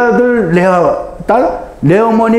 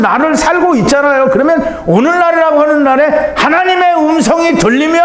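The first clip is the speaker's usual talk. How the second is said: preaching at full force; 8 semitones higher than usual. Real, not acted. Preacher urging the congregation, rising fervent preaching. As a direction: voice climbing high in fervent preaching.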